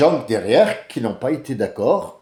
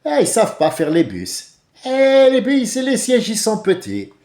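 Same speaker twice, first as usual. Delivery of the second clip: higher, louder, mock Arabic accent; 9 semitones higher, 6 dB louder than usual. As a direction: exclaim the complaint in a put-on North African accent. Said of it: "Mais avec l'accent arabe". (accent arabe appuyé, plus aigu et plus fort)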